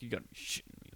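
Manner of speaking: whispered under the breath. Retold or imitated. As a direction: mutter it under your breath. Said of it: "under my breath".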